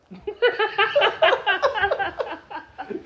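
bursting into a high laugh; big laugh. Real, not acted. Laughing at the idea of being a neighborhood tyrant. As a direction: laugh out loud at your own joke.

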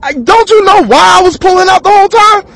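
high, cracking cry of outrage; retelling a panicked angry outburst; incredulous yell. Retold or imitated. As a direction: yell it at him in disbelief.

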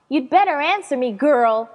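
loud, scolding yell; angry shout. Acted. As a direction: shout an order at the doll. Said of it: "I shouted".

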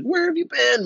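high mock-worried question; imitated concerned friend. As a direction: imitate a friend exclaiming where you've been. Friend who lost him, voiced.

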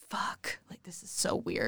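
whispered under breath; hushed, horrified whisper. Retold or imitated. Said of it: "I was like".

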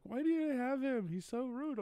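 high, loud prissy complaint; whiny complainers. Retold or imitated. Viewers complaining about the rude quiz host, high and whiny. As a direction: whine it like offended viewers. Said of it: "They're always like".

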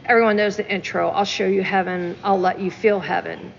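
ordinary talk, nothing marked

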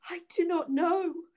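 poor woman crying out in fear; frightened cry. A narrator's reading. Wife denies to Bluebeard, frightened cry. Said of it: "cried the poor woman, paler than death".

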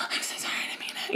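rapid, hushed mock pleading; panicked whispered apology. Apologizing to a sensitive friend, frantic whisper. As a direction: rush it out in a whisper.

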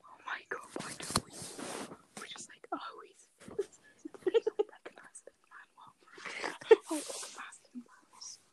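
whispering. Real, not acted. Still whispering, parents asleep.

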